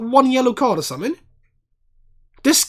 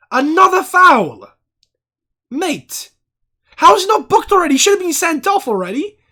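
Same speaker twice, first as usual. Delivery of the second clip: loud, high, furious yelling at the ref; furious shout; 9 semitones higher, 9 dB louder than usual. Real, not acted. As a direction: rage at every foul, shouting it out. Furious at repeated fouls.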